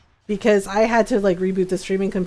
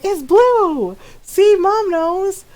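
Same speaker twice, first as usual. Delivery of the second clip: high, loud, slow gloating; triumphant shout; 9 semitones higher, 6 dB louder, much slower than usual. Retold or imitated. Telling the friends Mom agrees, in a loud slow gloat. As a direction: rub it in, louder each word. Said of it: "I'm just like".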